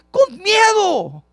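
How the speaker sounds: high, drawn-out loud exclamation; soaring preacher's punch; emphatic cry. Real, not acted. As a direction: shout the answer out slowly. Preacher cries answer to own question.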